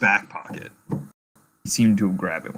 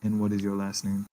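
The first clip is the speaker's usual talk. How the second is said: put-on softer German-accented question; 9 dB quieter than usual. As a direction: ask quietly in a German accent. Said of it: "And then he looks".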